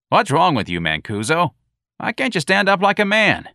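high, loud scolding; scornful sergeant. Acted. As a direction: berate him scornfully. Sergeant berating patrolman.

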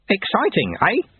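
high croaky bird voice; hoarse raven voice. Acted. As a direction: rasp it like an old raven. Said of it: "said a hoarse voice".

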